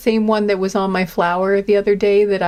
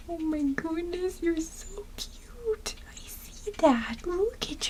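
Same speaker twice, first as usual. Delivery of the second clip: whispering; 6 semitones higher, 9 dB quieter, slower than usual. Real, not acted. Whispering to the ladybug.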